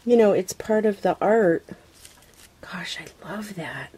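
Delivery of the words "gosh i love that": hushed, breathless whisper; soft private whisper; awed whisper. Real, not acted. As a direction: breathe it out in wonder. Admiring the gift quietly.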